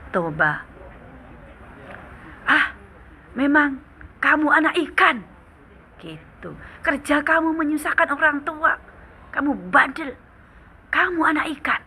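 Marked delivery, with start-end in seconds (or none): 2.5-5.3 high, loud angry outburst; furious father. Acted. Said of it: "seketika dia murka". 6.8-11.8 high, loud angry outburst; furious father. Acted. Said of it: "seketika dia murka".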